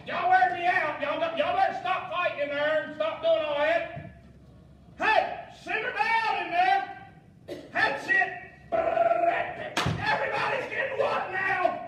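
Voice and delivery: acted loud, high scolding, building to a shout; exasperated parent yelling. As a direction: holler at rowdy kids in the next room. Acted parent yelling at fighting kids.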